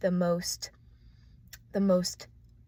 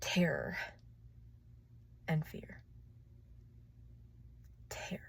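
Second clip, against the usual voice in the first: breathy whisper, dropping softer; 3 semitones lower, 10 dB quieter than usual. Real, not acted. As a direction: let it fade to a whisper. Whispers the fearful words.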